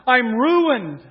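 loud, high, slow cry of woe; a loud, drawn-out lament; anguished wail. Acted. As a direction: cry it out as a man who has seen God. Isaiah's anguished cry retold.

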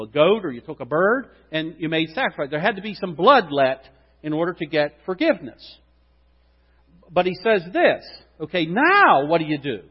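ordinary talk, nothing marked

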